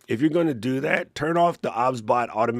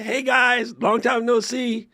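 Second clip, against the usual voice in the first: much higher, sing-song mock greeting from a friend who vanished; 13 semitones higher, slower than usual. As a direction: tease her with a high, breezy hello. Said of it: "she pop up in the chat like".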